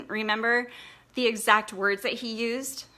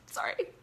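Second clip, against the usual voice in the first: teary breathy laugh; 6 dB quieter than usual. Real, not acted. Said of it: "I'm more emotional".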